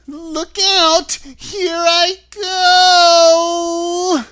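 very high, loud, long-drawn scream; dying witch wailing at full force; melting witch's final shriek. A narrator's reading. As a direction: shriek it, stretching the last word. Witch shrieks as she melts away.

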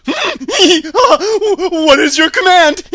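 loud, high, very slow screech with chattering laughter; hammed-up, drawn-out monkey shout; winged monkey king. A narrator's reading. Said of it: "The king bowed low before Dorothy and asked".

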